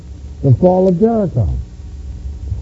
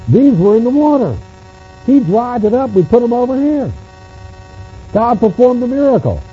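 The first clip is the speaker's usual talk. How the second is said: lightly acted parent, pitched higher; acted parent's explanation; 6 semitones higher than usual. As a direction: explain it to the children in short, raised phrases. Acts out telling children about stones.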